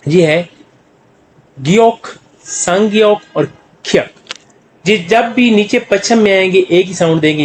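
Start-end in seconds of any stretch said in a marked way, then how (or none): 1.6-4.1 ग्योक, संग्योक और ख्यक को धीरे-धीरे साफ़ अलग करके बोलें; हर नाम को सिखाने वाले अंदाज़ में अलग से पढ़ें; कोरियन अक्षरों के नाम. Shown as a demonstration. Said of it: "मतलब फर्ज करें". (ग्योक, संग्योक, ख्यक का साफ़ कोरियन उच्चारण)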